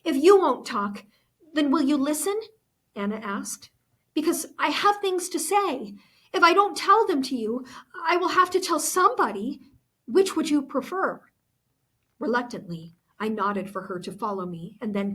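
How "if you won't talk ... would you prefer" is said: put-on higher, tense girl pressing to be heard; Anna's pleading voice. Anna begging Goethe to hear her out, nervous. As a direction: urge her to listen, nervous but insistent.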